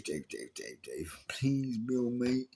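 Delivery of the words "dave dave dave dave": much lower and softer, giving up; pleading to Dave, trailing off; muttered plea. Real, not acted. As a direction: sigh his name under your breath.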